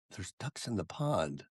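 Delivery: retold stunned reply, slow and very soft; quiet, drawn-out astonished question; hushed disbelief. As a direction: say it slowly, barely daring to believe it.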